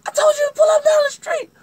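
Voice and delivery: shrieking, very high and fast; piercing hammed-up alarm; scared protest. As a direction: act out a panicked woman scolding. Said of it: "she was scared to get out".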